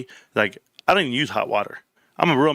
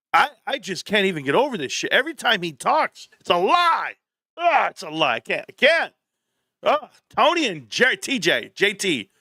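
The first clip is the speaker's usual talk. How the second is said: exasperated disbelief; 6 semitones higher than usual. Real, not acted. Exasperated about Tony lying.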